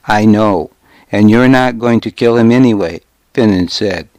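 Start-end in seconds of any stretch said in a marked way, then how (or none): none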